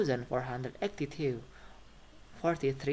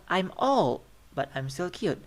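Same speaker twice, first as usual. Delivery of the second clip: put-on "old" rises to show more is coming; rising intonation; 4 semitones higher, 3 dB louder than usual. Shown as a demonstration. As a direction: lift "old" high and louder, then settle on "cute". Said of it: "Is rising intonation".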